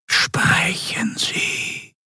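slow, whispered voice of command; menacing Excellency. A narrator's reading. Ruler demanding the admiral's report, slow menacing whisper. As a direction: whisper each word with drawn-out authority. Superior orders him on.

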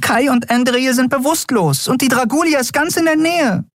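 very high, pleading question; a tiny fairy at her wits' end; desperate fairy. A narrator's reading. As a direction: ask it small, high and lost. Fairy continues despairing.

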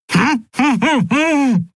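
very high panicked hums through a gag; panicked gagged hums. Acted. Gagged captain panics at her crew, frantic hums. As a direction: squeal muffled hums in terror. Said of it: "sagte Zaylar panisch".

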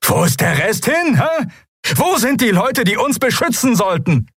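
high, strained, building outcry; angry tirade. A narrator's reading. Bearded man berates the guards for abandoning them, furious. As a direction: rant at them before the crowd.

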